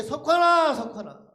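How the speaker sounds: high, very loud name call, then dropping off. Retold or imitated. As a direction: belt out the name as if from outside. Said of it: "더 크게". (더 크게 외쳐 부르는 소리)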